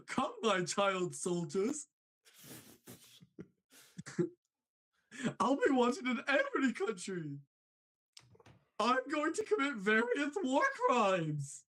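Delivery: menacing cartoon clown joke; Chuckles the Clown. Showing his newest impression, Chuckles the Clown. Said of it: "Chuckles the Clown".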